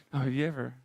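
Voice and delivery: usual speaking voice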